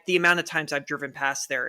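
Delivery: usual speaking voice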